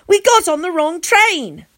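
high, loud outburst; blurting out. A narrator's reading. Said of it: "I blurt out".

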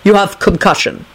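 put-on accented, matter-of-fact diagnosis; Dragomir's accented voice. A narrator's reading. Dragomir diagnosing dazed Lupin, accented. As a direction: state it as a foreign healer.

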